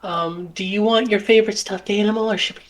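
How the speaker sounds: high-pitched put-on mom voice; high, coddling mother act; doting fussy mother. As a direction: fuss over your child in a high voice. Plays the mother.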